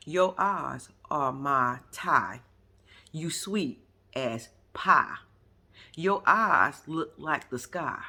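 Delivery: very slow, low, drawn-out verse; mocking poem recital. Mocking Hottie's stupid rap poem, slow mock recital. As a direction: recite a bad love poem, dragging every phrase. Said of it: "Did y'all hear that stupid ass poem".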